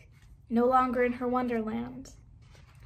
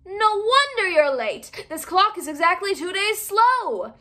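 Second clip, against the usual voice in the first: Mad Hatter; 6 semitones higher, 5 dB louder than usual. Acted. Hatter speaks, quoted.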